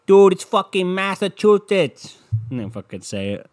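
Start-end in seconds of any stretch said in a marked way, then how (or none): 0.0-2.2 loud, high, slow mock Boston accent; exaggerated Boston local yelling the name. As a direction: ham up a thick Boston accent. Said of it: "with their fucking thick accents".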